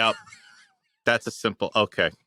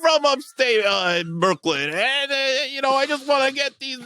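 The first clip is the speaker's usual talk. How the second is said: mock Tim Curry accent; 12 semitones higher, 3 dB louder than usual. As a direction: ham up a phony accent, way up in pitch. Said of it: "now the accent makes sense".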